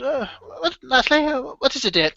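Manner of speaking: put-on high, mild old man's voice; doting old husband. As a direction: fuss over her absently.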